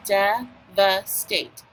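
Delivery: DEVASTATE very slowly in three beats; slow syllable-by-syllable devastate; split into syllables. Shown as a demonstration. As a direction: break devastate into de, va, state. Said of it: "let's do our syllables".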